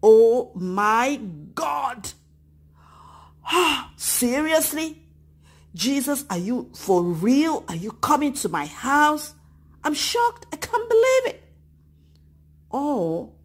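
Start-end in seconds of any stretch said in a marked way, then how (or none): 0.0-11.5 rising to a high disbelieving cry; shocked. Acted. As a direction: act out a child's shock. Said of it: "would you be shocked?".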